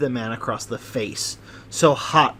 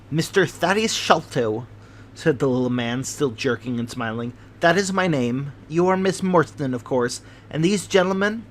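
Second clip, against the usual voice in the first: fidgety host; 2 semitones higher than usual. Acted. Sholto's voice held.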